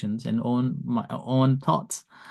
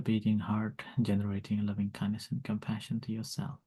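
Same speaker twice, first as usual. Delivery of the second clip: guided meditation; 3 semitones lower, 5 dB quieter than usual. Acted. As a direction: lead them gently into calm. Leads loving kindness meditation.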